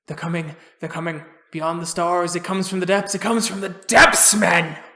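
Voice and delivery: frantic survivor, building from hushed to shouting; acted panic rising to a yell; panicked raving. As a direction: rave in mounting terror, bursting out at the end. Revived victim panics, GM voicing him.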